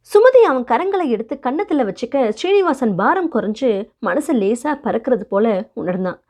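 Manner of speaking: plain reading voice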